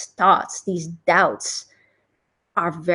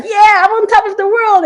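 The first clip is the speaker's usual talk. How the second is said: much higher and louder, bursting with excitement; on top of the world; 12 semitones higher, 8 dB louder than usual. Retold or imitated. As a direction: throw it out like you just conquered everything. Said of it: "You're like".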